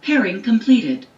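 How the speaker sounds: ordinary delivery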